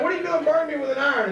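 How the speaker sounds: high, rapid indignant demand; retold anger. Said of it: "But I'm mad".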